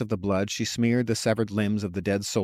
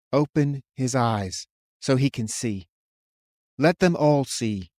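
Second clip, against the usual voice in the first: simple imploring; 3 semitones higher than usual. A narrator's reading. Man praying skyward for his doubting friend, imploring. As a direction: implore simply, giving each word room. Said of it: "in a simple, imploring tone".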